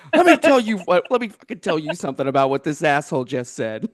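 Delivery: high, loud burst easing into laughter; half-laughing imitation of a rant; mock yelling. Streamer yelling at their screen over a video, mock outrage. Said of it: "yelling at my screen".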